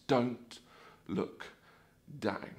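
soft, word by word with pauses; warning sign read out. Acted. Ride's advice at the edge of the drop, hushed warning. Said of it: "these wonderful words of advice".

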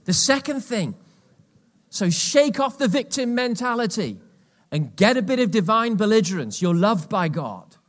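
rally the church, full voice; preaching loud with divine belligerence. Acted.

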